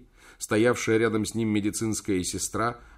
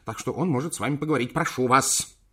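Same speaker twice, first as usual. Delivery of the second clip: fast, fidgety official; nervous hurry; 4 semitones higher, faster than usual. Acted. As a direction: rattle it off nervously. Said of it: "нервно суя мне руку".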